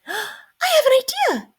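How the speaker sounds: high bright mouse squeak; very high eager squeak; squeaky mouse. A narrator's reading. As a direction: squeak it like a little mouse struck by an idea. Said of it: "squeaked Mouse".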